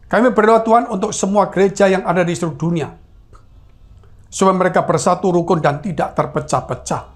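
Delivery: measured, even public prayer cadence; pastoral prayer. Real, not acted. Pastor prays to God for the churches, steady prayer. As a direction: pray aloud in long, steady phrases.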